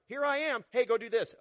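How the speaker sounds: God calling Roger to a task; eager call. Retold or imitated. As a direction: hail him and hand out the job.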